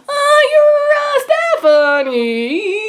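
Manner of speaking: high, stretched-out silly guess; being really weird. Retold or imitated. Actor guessing a fan's name, being weird. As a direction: draw each word out in a goofy high voice. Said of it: "really weird and whatever".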